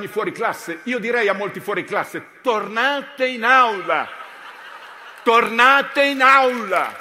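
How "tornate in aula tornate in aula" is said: louder, slower, and rising in pitch; hammed-up schoolmaster shout; mock stern order. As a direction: send the stars back to class like a strict teacher. Ordering star players back to class.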